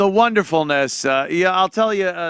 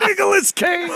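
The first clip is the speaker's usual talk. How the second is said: Nicolas Cage impression; 12 semitones higher than usual. Host mimicking what everyone says after a Cage movie, higher. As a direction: squeal the name like a fan. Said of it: "not go".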